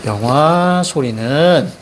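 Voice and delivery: 영화 and 소리는 each drawn out at the end; slow model reading with long endings on 영화 and 소리는; chunked reading demo. Shown as a demonstration. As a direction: stretch 영화, break, then stretch 소리는. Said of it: "이렇게 읽어줘야죠".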